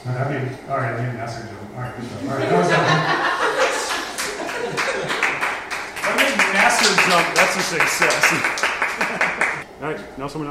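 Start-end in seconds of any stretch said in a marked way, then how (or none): 3.1-6.0 breathy, high delighted giggle; high giggling laugh. Real, not acted. Narrator pleased after the jump scare, giggling.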